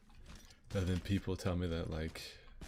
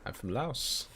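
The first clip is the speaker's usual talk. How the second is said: put-on higher, Laotian-accented line; Mister Khan accent; 5 semitones higher, slower than usual. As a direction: say it proudly in a Lao accent. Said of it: "you sound like Mister Khan".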